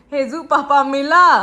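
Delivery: put-on loud, drawn-out call to a child; playful calling out. Mother asks child if papa was found, calling out. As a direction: call out slowly across the game.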